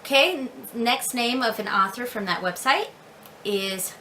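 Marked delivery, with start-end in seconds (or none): none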